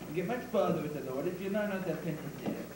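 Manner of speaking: usual speaking voice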